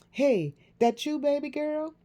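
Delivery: high, loud eager greeting; light up hearing her daughter; excited. A narrator's reading. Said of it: "sounding the same way Jasmine had just come off".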